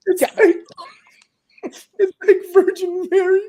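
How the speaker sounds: high, halting, laugh-choked imitation; crying with laughter. Stoned woman denying a fart on camera, mock crying with laughter. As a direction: mimic her struggling to get words out through laughter. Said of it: "like, fucking crying".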